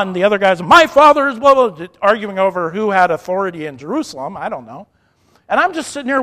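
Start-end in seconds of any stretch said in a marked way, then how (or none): 0.6-1.9 loud, high retort in a mock quarrel; imitated shouted comeback; yelling back. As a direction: shout back, topping the other man. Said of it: "they're yelling back and forth".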